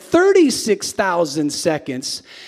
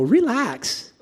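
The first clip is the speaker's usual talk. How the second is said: teasing relax; 7 semitones higher than usual. Shown as a demonstration. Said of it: "look at somebody next to you and go".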